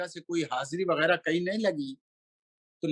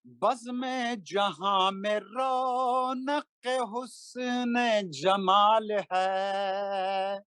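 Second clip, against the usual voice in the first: slow melodic chanted Urdu verse; stately nazm recitation; 4 semitones higher, much slower than usual. Acted. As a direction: intone each line like a mushaira poet. Recites a manqabat at a gathering.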